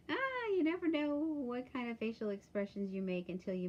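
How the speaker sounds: high, loud, coming out of a laugh; laughing at oneself, settling as it goes; amused. Real, not acted. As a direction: exclaim it through the tail of a laugh. Amused after laughing at herself.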